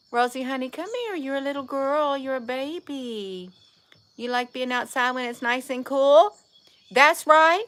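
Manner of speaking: high doting voice for the cat. Real, not acted. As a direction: coo tenderly at the cat. Cooing to the cat again.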